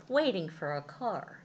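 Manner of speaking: usual speaking voice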